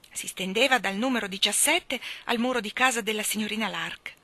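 ordinary delivery, nothing marked

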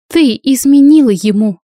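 staged loud accusation; deliberately loud. Acted. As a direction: announce it loudly so everyone hears. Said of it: "нарочито громко ответила Стейси".

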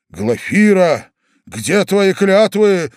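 high, loud, drawn-out reproach; impassioned cry. A narrator's reading. Young man grabs the lady's arm, crying out reproachfully. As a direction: cry out slowly and dramatically. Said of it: "вскричал молодой человек в бекеше".

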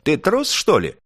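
high, loud scornful question. A narrator's reading. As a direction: sneer it as a challenge. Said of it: "презрительно поинтересовалась Звёздочка".

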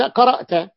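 قرأت said high with the final ت stressed; demonstrating the ending. Shown as a demonstration. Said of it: "مثل تقول لواحد قرأ أنت".

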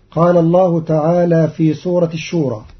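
ordinary talk, nothing marked

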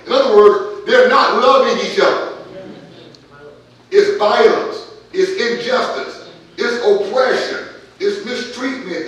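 loud, rising preacher's call; preaching at full force. Acted. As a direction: build the sermon to a loud peak.

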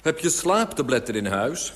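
urgent call from the street below. A narrator's reading. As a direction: shout the question up to an upstairs window. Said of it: "riep hij".

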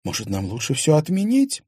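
a lightly voiced nervous wife; anxious wife.

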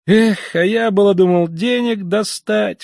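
high-pitched regretful cry, drawn out at the end; exclaiming. A narrator's reading. Young man who hoped to get money, high exclamation. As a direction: cry out over a lost chance. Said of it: "вскричал молодой человек".

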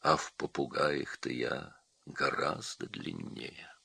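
low, soft, slow musing; quiet, thoughtful snake voice; pensive boa. A narrator's reading. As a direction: muse it slowly to yourself, low. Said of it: "задумался вдруг удав".

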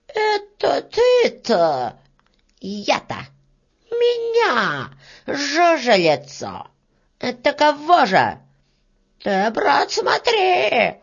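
high squealing outrage, stretched out; beetle, affronted. Acted. Beetle faces the threatening worker, squealing indignantly.

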